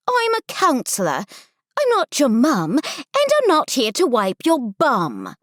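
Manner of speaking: high, forceful British counselor; heavy British accent. Acted. Counselor introducing herself to campers, heavy British accent. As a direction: address the crowd in broad British English. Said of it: "she says in a heavy British accent".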